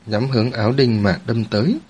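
usual speaking voice

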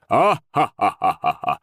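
slow, deliberate fake guffaw; mock hearty ha-ha-ha; forced booming laugh. A narrator's reading. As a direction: laugh on purpose, syllable by syllable. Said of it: "загромыхал батя".

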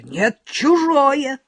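very slow, high, loud retort; shouting. A narrator's reading. Valechka yelling back at Lyolka, slow loud retort. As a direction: drag it out as a yell. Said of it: "кричит Валечка".

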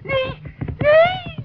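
loud, high panicked cries; alarmed protest. Acted. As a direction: fend off a pursuer in alarm. Witch chased by threatening spirit. (geschrokken uitroep)

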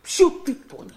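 high, insistent scolding of his son. Acted. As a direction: repeat it, cutting off the excuse. Father rebukes son's excuse.

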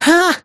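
sharp short cry of pain. Acted. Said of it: "крик боли девушки".

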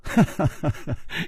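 breathy, falling run of chuckles; amused chuckle. Acted. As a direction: laugh knowingly at your friend. 楚留香忍不住笑. (呵呵轻笑)

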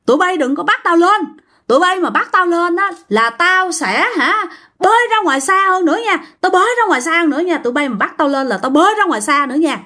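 high, stubborn shouted refusal; high-pitched defiant refusal; defiant yelling. Retold or imitated. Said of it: "la quá trời".

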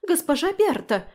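high, loud indignant call; crying out. A narrator's reading. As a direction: call out shrilly for the matron. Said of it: "закричала Фелиция".

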